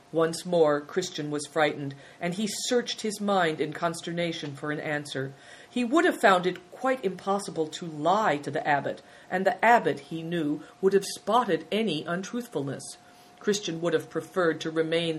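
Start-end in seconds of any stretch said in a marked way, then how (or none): none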